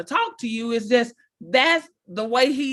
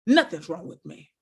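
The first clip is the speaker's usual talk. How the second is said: curt denial; 6 semitones lower than usual. Retold or imitated. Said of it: "What does Sarah say".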